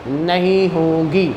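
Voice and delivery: usual speaking voice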